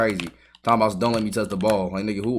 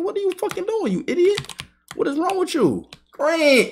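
high-pitched disbelieving rant at his own player; exasperated high yelling. Real, not acted.

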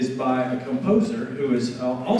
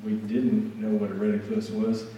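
ordinary talk

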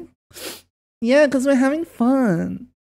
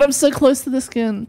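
ordinary delivery

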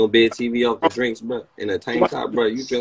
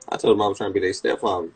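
ordinary talk, nothing marked

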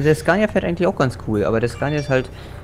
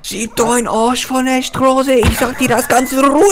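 higher, louder slow shout; mock shouting; 11 semitones higher, 5 dB louder than usual. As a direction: rant in exaggerated fury. Mock rant at other driver.

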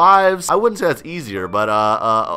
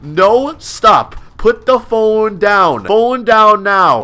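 loud, slow, drawn-out order; forceful command; 5 semitones higher, 6 dB louder, much slower than usual. Pretend. Burglar orders a woman to put the phone down, forceful. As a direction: order it loudly, stretching every word.

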